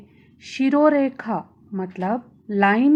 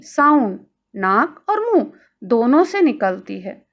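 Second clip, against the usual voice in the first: usual speaking voice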